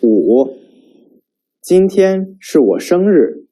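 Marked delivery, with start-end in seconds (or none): 1.6-3.5 今天是我生日 asked, pitch high and rising on 生日; rising question tune. Shown as a demonstration. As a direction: lift 生日 like asking. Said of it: "pay attention to the intonations".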